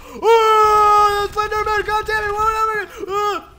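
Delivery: very high, loud shriek at a game scare; panicked scream. Real, not acted. Slenderman attacks in game.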